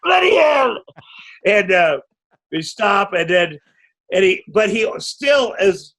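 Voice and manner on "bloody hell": high shouted British cry of pain; British yelp of pain. Retold or imitated. Punched actor cries out in pain, British shout. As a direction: howl it like a hit Brit. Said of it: "he's like".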